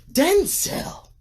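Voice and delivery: fangirling over Denzel Washington, high and loud; swooning gush. Real, not acted. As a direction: belt "Denzel" out in adoration.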